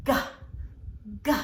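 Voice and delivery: "G" as a short hard guh sound, repeated; teacher modelling the letter sound "G" for kids. Shown as a demonstration. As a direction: say "G" as just the sound guh, crisp. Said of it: "the sound it makes is".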